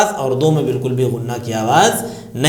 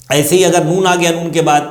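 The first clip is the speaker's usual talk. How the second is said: usual speaking voice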